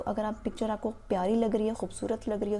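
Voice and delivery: ordinary talk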